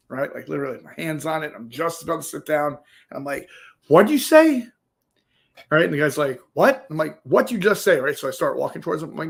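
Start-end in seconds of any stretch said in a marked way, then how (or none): 3.9-4.7 sharp, much higher and louder challenge; confrontational challenge. Retold or imitated. Confronting a guy who insulted him. 6.5-6.8 high, louder startled what; defensive what. Retold or imitated. The guy replying defensively. 7.2-8.0 higher, louder pressing challenge; escalating challenge. Retold or imitated. Escalating the confrontation.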